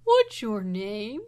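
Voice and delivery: slow, loud, drawn-out monster voice; dinosaur voice. Acted. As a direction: stretch it out like a big dinosaur. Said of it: "the boy".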